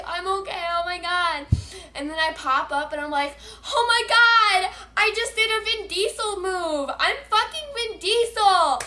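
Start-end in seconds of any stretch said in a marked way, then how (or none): 0.1-1.5 high-pitched, re-enacted giddy relief; hysterical. Rider just after the crash, re-enacted hysterics. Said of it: "I'm, like, hysterical". 3.7-8.8 high, loud re-enacted excited yelling; retold screaming. Rider popping up after the crash, excited screaming. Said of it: "I'm, like, screaming".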